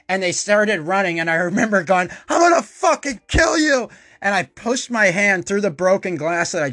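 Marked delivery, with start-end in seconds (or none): 2.3-3.9 high, loud enraged threat; furious threat. Retold or imitated. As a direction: scream it like a berserk kid. Enraged kid chasing brothers.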